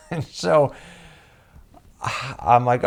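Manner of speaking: ordinary talk, nothing marked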